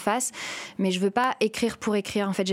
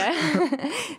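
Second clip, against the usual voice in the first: a short, higher nervous laugh; brief laugh; 8 semitones higher than usual. Real, not acted. Guest talking about her stepmother, short higher laugh. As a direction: laugh briefly while searching for words.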